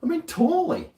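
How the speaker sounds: high-pitched exasperated correction; insist, squeaky and annoyed; high indignant reply. Retold or imitated. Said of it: "I was like".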